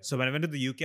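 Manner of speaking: ordinary delivery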